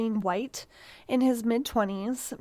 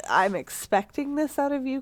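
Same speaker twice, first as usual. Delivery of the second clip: stern parent voice; 4 semitones higher than usual. Retold or imitated. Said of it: "not in a".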